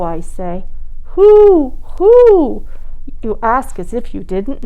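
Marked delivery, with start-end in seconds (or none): 1.2-2.6 high, loud owl hoot. Pretend. Child hooting like an owl.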